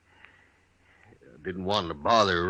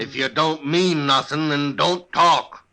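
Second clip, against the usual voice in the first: cold warning; 7 semitones higher, 6 dB louder than usual. Acted. Husband warns off a bigot.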